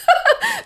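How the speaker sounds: very high short giggle; laugh. Real, not acted. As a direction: let out a high little laugh. Host laughs at own wording.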